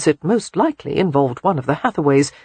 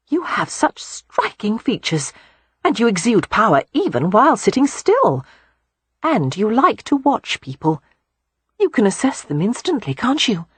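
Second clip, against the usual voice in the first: put-on higher, admiring young woman; 6 semitones higher than usual. A narrator's reading. Beatrix flatters the Earl, higher and admiring. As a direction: flatter him sincerely.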